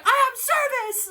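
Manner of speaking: high-pitched, triumphant imitation; excited mock exclamation. Reader imitates him announcing phone service, excited cry. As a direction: call it out like great news. Acting out character's joyful announcement.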